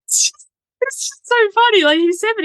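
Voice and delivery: high, squeezed words through laughter; cracking up at your own story; laughing while talking. Real, not acted. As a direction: tell it while giggling, pitch way up.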